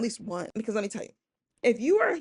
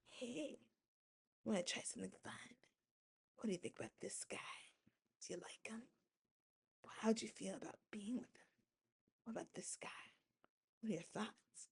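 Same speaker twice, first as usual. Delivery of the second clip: hushed, much softer luring whisper; sly whisper; 16 dB quieter than usual. Retold or imitated. [name] whispering to his girlfriend, luring her in. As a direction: draw her in with a secretive hush.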